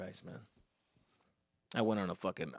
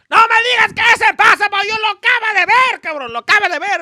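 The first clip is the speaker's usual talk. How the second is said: high, loud furious yell in Spanish; angry dad shouting; 10 dB louder than usual. Retold or imitated. Dad yelling at son over the internet, furious shout. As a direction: bellow at the kid like an enraged father.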